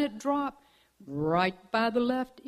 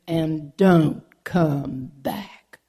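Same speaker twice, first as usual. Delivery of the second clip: slow warning; 6 semitones lower, 6 dB louder, much slower than usual. Acted. Hawk dismisses bobcat.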